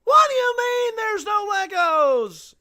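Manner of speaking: loud high-pitched Seinfeld rant; Seinfeld impression. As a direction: do Jerry Seinfeld's exasperated bit. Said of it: "That's, that's Jerry Seinfeld right there".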